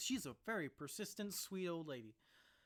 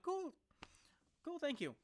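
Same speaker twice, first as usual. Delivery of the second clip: delighted high thanks; 12 semitones higher than usual. Retold or imitated. His dad offers him the car.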